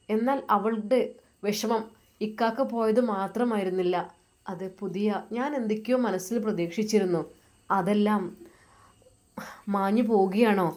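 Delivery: plain reading voice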